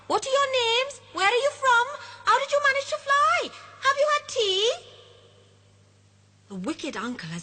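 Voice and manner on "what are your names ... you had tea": put-on high, loud, fast excited children; a crowd of children squealing with joy. Children questioning eagerly.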